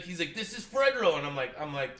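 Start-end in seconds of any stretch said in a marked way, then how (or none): 0.3-1.2 loud. Retold or imitated. Caller identifies himself.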